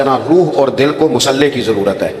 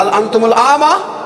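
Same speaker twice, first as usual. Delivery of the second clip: slow, raised, offended Arabic challenge; indignant high Arabic question; 7 semitones higher, slower than usual. Retold or imitated. Old man woken by a trodden foot, slow indignant challenge. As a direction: throw the question up at him, slowly. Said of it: "اس بزرگ نے بھی یہی جملے کہے".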